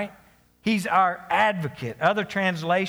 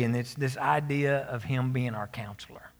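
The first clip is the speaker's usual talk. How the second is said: normal talking voice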